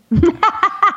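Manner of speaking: a burst of bright laughter; high laugh. Real, not acted. Laughing at own joke.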